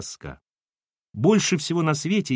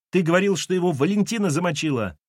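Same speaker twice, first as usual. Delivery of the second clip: put-on high-pitched boyish recollection; blurting child; 6 semitones higher than usual. Boy blurts out remembered accusation.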